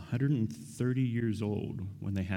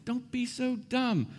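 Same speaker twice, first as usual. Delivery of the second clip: high, exasperated yell at a movie; shouting at the screen; slower than usual. Pretend. Preacher yelling at a movie screen, high and exasperated. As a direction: scream warnings at a dumb movie character. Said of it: "I'd be shouting at the screen".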